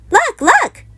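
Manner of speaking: high, loud excited cub cry; excited shout. A narrator's reading. Sister shouting to her family about a new ride. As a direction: squeal it out, thrilled by the new ride. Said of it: "shouted Sister".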